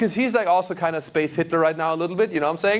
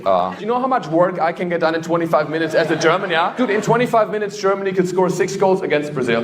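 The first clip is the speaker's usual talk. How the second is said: mock scolding; 2 semitones higher, 7 dB louder than usual. Scolding late crowd member.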